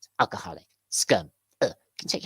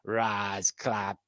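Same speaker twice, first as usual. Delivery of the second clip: ordinary talk, nothing marked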